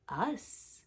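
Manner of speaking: hushed, grave whisper of a single word; grim whisper. Acted. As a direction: breathe it out like a dark secret. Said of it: "he said something very surprising".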